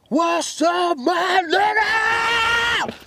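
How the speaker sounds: high, slow, sung-out shout; excited greeting. Retold or imitated. [name] greeting his old friend on the phone, high, slow sung-out shout.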